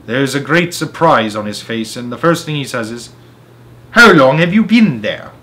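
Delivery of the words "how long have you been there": scharf und ungehalten, eine Spur lauter; sharp, displeased question, louder. Retold or imitated. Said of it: "He says it sharply".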